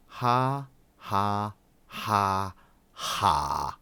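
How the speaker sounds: deliberate, separate fake laughs; spoken "ha"s stepping down in pitch; mock laugh. A narrator's reading. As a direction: sound out a humourless laugh, beat by beat. Alien spells out a scornful laugh.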